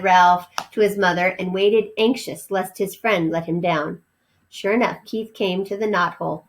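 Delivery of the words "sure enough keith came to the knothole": ordinary delivery, nothing marked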